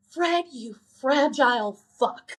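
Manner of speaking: slow, deliberate imitated insult; acted put-down. Heroine looks at Fred and delivers her slow, scornful put-down. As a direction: draw out each word as a verdict. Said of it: "she just looks at him".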